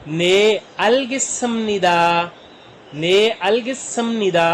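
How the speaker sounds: slow, drawn-out Korean answer; slow model answer. Shown as a demonstration. Teacher models the students' polite answer slowly.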